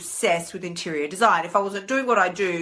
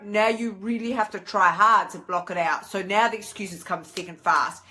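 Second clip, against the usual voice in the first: normal talking voice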